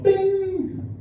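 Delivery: high pitched imitated timer ding; ding it like an oven timer; timer bing.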